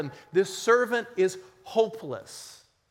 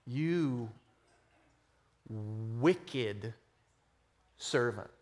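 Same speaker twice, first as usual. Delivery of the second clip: stern master; 8 semitones lower, 7 dB quieter, much slower than usual. Acted. Master condemns servant in parable.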